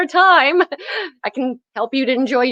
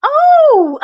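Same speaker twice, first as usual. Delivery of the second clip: much higher, loud gleeful oh and giggle; delighted squeal; 13 semitones higher, 5 dB louder than usual. Acted. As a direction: squeal with wicked glee. Virus puppet reacts with delight.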